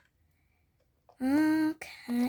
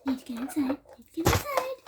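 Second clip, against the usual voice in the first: panicked urgency; 3 dB louder, faster than usual. Acted. Cat panicking at shop door.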